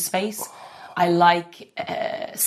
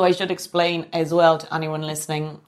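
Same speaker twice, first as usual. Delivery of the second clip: ordinary delivery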